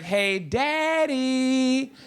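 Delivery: put-on teasing child dragging out the call; drawn-out daughter call. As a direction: stretch "daddy" out like a kid milking it.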